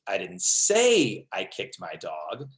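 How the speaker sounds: slow, with a big swell on "say"; stress on SAY. Shown as a demonstration. Trainer showing the same sentence differently, stress on say. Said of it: "same sentence with seven different meanings".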